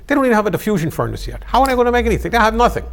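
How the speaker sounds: loud, high mock outburst; mock exasperation. Bulgarian at a cocktail party scoffing about Moscow, exasperated.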